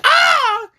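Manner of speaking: high, loud mock yell; hammed-up high yell; intruder's yell. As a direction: let out a startled high yelp. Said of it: "he's like".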